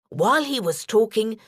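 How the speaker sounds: plain reading voice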